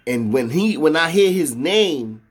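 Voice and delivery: usual speaking voice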